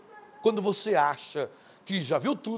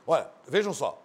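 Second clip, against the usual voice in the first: ordinary talk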